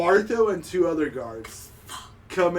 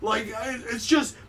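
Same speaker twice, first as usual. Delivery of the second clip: higher, babbling excuses; flustered husband; 6 semitones higher than usual. Acted. Man caught looking at a woman, flustered higher. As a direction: stammer out a guilty excuse. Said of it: "she's like".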